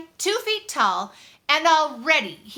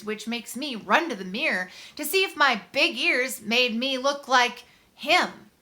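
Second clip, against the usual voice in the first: ordinary delivery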